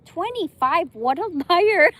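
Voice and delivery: loud, high scoff through laughter; laughing disbelief. Real, not acted. Reading his claimed age, loud and laughing. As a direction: call him out while cracking up. Mocking profile's fake age.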